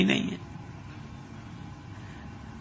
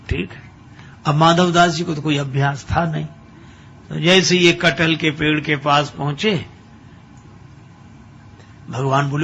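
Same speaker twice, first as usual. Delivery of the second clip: ordinary talk, nothing marked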